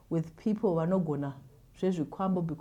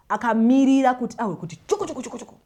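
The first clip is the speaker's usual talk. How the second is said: mock crawling sound; 6 semitones higher, 10 dB louder than usual. Imitating crawling sensation.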